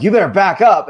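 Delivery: mock an upset man warning someone off. Upset stranger warns him to back off at Home Depot, upset. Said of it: "he got really upset".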